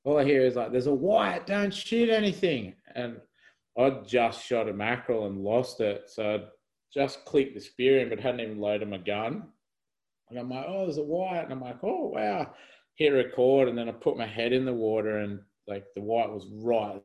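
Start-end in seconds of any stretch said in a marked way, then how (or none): 0.7-2.7 retold warning yell. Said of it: "they started yelling". 10.7-11.4 surprised. Retold or imitated. Spotting the shark. 11.8-12.5 high retold oh wow; amazed. Spotting the shark.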